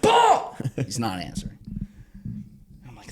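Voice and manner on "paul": high, loud yell of the name. Retold or imitated. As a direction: shout to wake him up. Yelling to wake friend.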